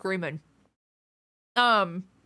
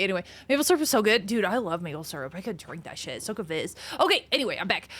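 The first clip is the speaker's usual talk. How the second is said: normal talking voice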